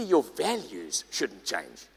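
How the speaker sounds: slow, low and deliberate, leaning on your; slow emphatic point. Real, not acted. Preacher stressing a core point to the congregation, slow and low. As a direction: lay your values shouldn't change down slowly. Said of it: "And secondly".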